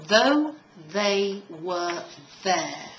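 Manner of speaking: slow, with voiced th in though and there. Shown as a demonstration. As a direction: buzz the th in though, they, there. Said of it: "Then we have the voiced or hard".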